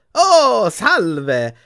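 big, high effusive hello; delighted greeting. A narrator's reading. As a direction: greet adoring fans grandly. Vain ex-professor greets his visitors.

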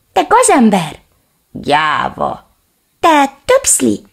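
high, loud, slow angry yelling; little dog screaming insults; shouted insults. Acted. Little dog yells insults at the reflection, loud and high. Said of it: "kiáltotta a kiskutya".

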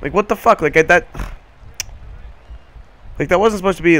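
exasperated, loud and high; frustrated outburst. Real, not acted. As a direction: vent at the bad pitch. Frustrated at bad pitch.

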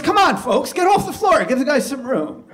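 hearty mock-DJ holler; DJ announcer. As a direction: clear the floor like a club DJ. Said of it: "He goes".

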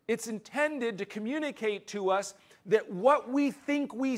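loud, high, emphatic pulpit voice; fervent preaching. Acted. Pastor preaching hard to his congregation, loud and urgent. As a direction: drive the point home to the room.

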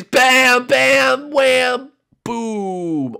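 high, loud blast words, the last one drawn out; shouted explosion noises. Pretend. Gamer blowing up Bushy, shouting the blasts. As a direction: yell each hit like an explosion.

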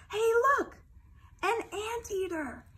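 high, loud call; excited discovery. Acted. Parent voicing the spotter, excited. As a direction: announce it with wonder.

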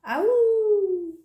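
loud, high howl, held long; answering wolf howl. A narrator's reading. Someone close by answers Little Bear, loud wolf howl. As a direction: howl back across the meadow. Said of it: "someone answered him".

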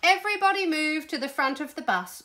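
loud, high command; urgent commanding call; ordering. A narrator's reading. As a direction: order everyone to move at once. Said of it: "ordered the Queen".

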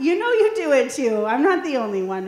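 ordinary delivery

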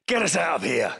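ordinary talk

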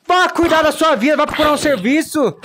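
loud, high telling-off of a pest; mock scolding. Player scolding a pest mob in the game, loud mock telling-off. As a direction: shoo the nuisance away, loud and sharp.

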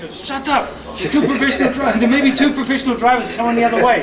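high, loud shout with a laugh; mock-angry driver yell; cab driver shouting back. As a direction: bellow the punchline at the passengers. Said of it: "He turns around and says".

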